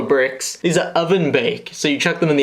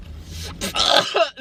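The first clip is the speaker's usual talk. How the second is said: breathy laugh; 11 semitones higher, 3 dB quieter than usual. Real, not acted. Laugh at hippie price.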